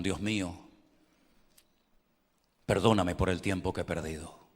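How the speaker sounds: oración suave y grave, despacio; guía a los fieles a pedir perdón; oración guiada. Acted. Leads the congregation's prayer. (oración recogida, voz baja)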